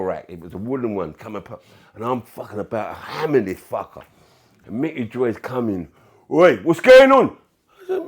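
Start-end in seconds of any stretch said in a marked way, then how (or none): 6.3-7.4 loud, high, drawn-out "Oi" shout; acted yell to break up a fight; shouted challenge. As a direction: burst in hollering, imitating a teammate. Acts out teammate arriving, calling out.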